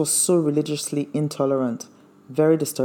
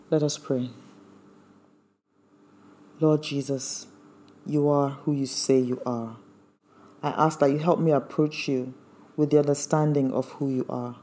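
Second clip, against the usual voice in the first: prayer. Acted. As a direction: pray it gently to the Lord. Closing prayer after sermon.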